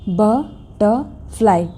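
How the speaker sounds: बटरफ्लाई slowly split, buh... tuh... fly; syllable by syllable. Shown as a demonstration. Teacher repeating for learners to count, syllables spaced out. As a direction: separate each beat of बटरफ्लाई. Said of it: "three syllables".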